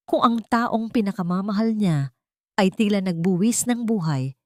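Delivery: usual speaking voice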